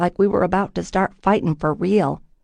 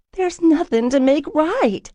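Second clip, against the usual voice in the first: high, slow pleading cry; cried out; 10 semitones higher than usual. A narrator's reading. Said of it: "I cried".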